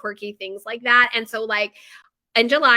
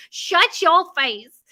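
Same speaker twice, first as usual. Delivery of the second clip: higher, slow, stretched mock order; TV show quote; 6 semitones higher, 5 dB louder, much slower than usual. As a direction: drag it out like the comedian. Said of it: "that is from".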